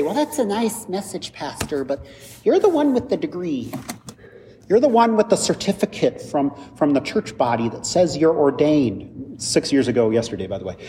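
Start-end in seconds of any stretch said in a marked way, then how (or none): 0.0-9.4 high, light voice of a doubting churchgoer; doubting congregant. Pretend. Congregant telling the pastor he has the degree, high doubtful voice. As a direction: play a skeptical listener needling the pastor. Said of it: "and you might say".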